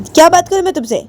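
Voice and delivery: high, indignant retort; angry refusal. Acted. (ऊँची आवाज़ में नाराज़ इनकार)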